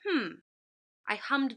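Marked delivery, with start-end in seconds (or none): 0.1-0.4 one short hum; a single closed-mouth hmm; humming. Shown as a demonstration. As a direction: hum the word as one beat. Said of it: "by humming the word".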